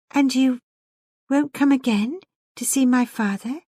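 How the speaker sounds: insistent. A narrator's reading. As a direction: press him to return, voice lifted. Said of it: "she insisted".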